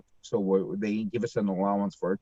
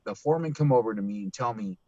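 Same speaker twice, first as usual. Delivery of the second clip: ordinary talk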